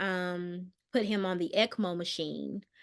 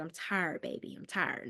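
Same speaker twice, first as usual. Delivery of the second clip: soft, fading, nearly whispered; exhausted and tired; 2 semitones lower, 8 dB quieter than usual. Retold or imitated. Husband telling his wife he is worn out, barely audible. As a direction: let the words die away in exhaustion.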